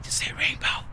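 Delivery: urgent whispered prompt; whispered coaching aside. Retold or imitated. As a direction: hiss it so the phone lady can't hear. Coaching dad quietly during call.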